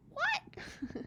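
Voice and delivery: very high squeak of disbelief; shocked. Acted. Hummingbird shocked by rock somersaults, squeaking high.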